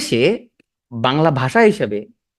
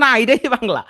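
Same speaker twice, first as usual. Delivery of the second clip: insisting colleague; 9 semitones higher, 3 dB louder than usual. Retold or imitated.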